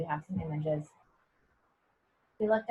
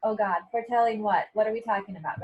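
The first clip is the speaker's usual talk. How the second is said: louder, puzzled Oh God, foretelling what; mock alarmed reader; 3 semitones higher, 6 dB louder than usual. Teacher voices a reader puzzled by the line, mock alarmed. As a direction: act out a startled reader's question. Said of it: "we have this whole line".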